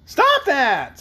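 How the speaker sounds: put-on high, loud, cross child's voice; cross little boy. A narrator's reading. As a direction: snap it like an annoyed small boy. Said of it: "in a cross voice".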